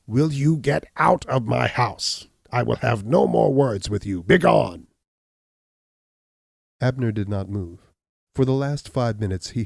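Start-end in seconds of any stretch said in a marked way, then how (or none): 0.1-4.9 louder, higher furious dismissal, slow at first; angry cry. Acted. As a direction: throw him out, ending in a roar on Begone. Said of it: "he cried".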